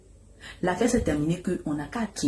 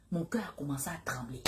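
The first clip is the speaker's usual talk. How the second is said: whispered dread; 5 semitones lower, 14 dB quieter than usual. Real, not acted. Fear on hearing Libanais.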